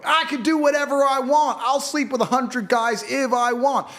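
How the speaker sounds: high, loud mocking imitation of a brash woman. Mocking the loud minority online, in a high put-on brash voice, slow at the end. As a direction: sneer it out like the five percent he mocks. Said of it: "these five percent of idiots you see going".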